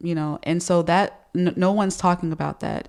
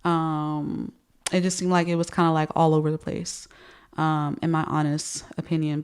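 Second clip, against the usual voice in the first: ordinary delivery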